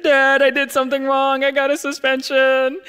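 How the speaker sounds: high, loud put-on sobbing voice; fake crying. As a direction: whine it out like a kid faking tears. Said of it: "fake crying".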